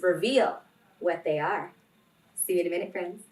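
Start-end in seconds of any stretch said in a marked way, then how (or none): none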